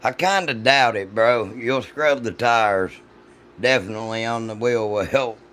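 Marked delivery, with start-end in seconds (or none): none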